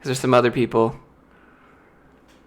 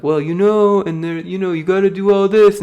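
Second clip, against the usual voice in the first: high, whiny nagging tone; mocking friends; 7 semitones higher, 3 dB louder than usual. Friends warning him about quitting, mocking whiny voice. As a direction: parody friends lecturing him. Friends giving cautious advice.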